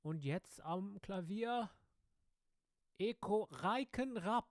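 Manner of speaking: high, slow German MC build-up; showy German stage introduction; German announcer. Retold or imitated. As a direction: announce the pianist grandly in German, drawing it out. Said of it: "So in Germany, like".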